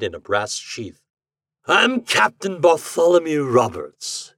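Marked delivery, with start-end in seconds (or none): 1.7-4.3 higher, slow, stately gentleman captain; measured gentlemanly sea captain; gentleman's voice. A narrator's reading. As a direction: introduce yourself as a grand gentleman. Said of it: "in a gentleman's voice".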